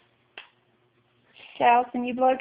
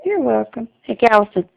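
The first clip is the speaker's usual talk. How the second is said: ordinary talk, nothing marked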